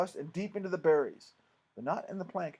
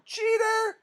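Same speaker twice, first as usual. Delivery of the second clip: high, loud drawn-out yell; outraged, high-pitched holler; angry shouting; 9 dB louder than usual. Acted. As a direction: shout the accusation across the fairground.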